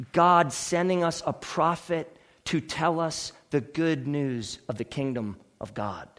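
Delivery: low, soft, measured preaching; quiet gravity. Real, not acted. Preacher explaining why Jesus was sent, quiet and grave. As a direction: bring it down and state it earnestly.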